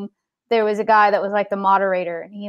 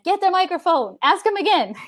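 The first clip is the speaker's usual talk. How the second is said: put-on insistent child; 8 semitones higher than usual. Child urging her dad.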